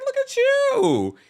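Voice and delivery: very high, drawn-out mock amazement; loud, slow, high-pitched admiring squeal; impressed exclamation. Host retelling reaction to friend's platter, impressed high exclamation.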